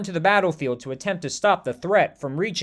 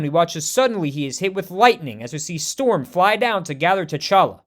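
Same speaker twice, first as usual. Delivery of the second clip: plain reading voice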